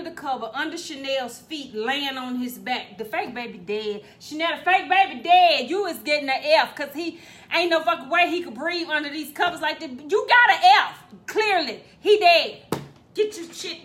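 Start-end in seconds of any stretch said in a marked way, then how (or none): 4.2-13.8 loud, exasperated scolding. Real, not acted. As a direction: scold her loudly. Scolding daughter over dead doll.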